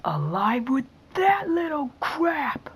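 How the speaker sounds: high, loud triumphant shout; excited cheer. Real, not acted. Surviving a tough level.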